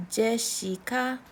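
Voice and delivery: Jessica as slow, even je-shi-ka; Korean-style pronunciation. Shown as a demonstration. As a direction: say Jessica in three smooth syllables with shi. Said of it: "we don't need the other S".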